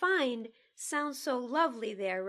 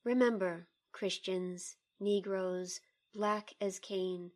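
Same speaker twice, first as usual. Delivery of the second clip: poem reading; 2 semitones lower than usual. Acted. Colleague asked to read the poem, measured poem reading. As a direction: read the poem with care, line by line.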